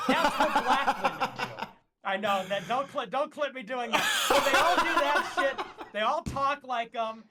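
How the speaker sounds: high, loud laughing speech. Real, not acted. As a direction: laugh through the words. Laughing at his own mimicry.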